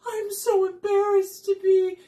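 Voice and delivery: high, loud mock wail; hammed-up apologetic lament; embarrassed Americans, mocked. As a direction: whine it as an exaggeratedly ashamed American. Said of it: "where everyone's like".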